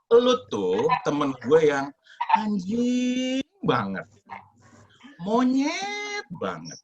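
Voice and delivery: drawn out slowly and high, as if paying a compliment; sweetly polite insult. Retold or imitated. To the friend mocking his fast, mock-sweet slow insults. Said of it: "kan tuh ngomongnya baik-baik".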